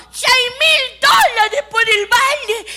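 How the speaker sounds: very high, loud, slow shout; shrieking bid. Retold or imitated. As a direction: outbid her with a shriek. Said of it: "Y otra gritó".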